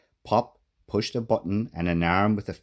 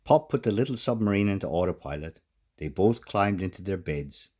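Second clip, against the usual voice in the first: ordinary delivery, nothing marked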